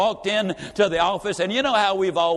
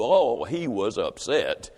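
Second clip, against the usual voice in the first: drawn-out low "Oh, he was upset"; deep, slow punchline refrain; 5 semitones lower, slower than usual. A narrator's reading. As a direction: drag it out low for emphasis. Storyteller's slow emphatic refrain.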